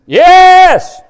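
loud, high, drawn-out yes; imitated congregant shout. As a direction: mimic a worshipper calling out loud. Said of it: "some were".

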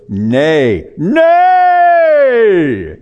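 preacher's cry rising to a long, high bellow; animated New Jersey preacher, getting very loud; Greg Nichols. Retold or imitated. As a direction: draw out the last one in a huge shout. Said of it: "in this New Jersey accent".

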